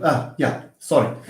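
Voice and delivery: ordinary talk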